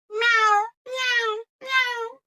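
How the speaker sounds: high cat meows; cat meowing. A narrator's reading. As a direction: mew like a cat. Cat's meows in story.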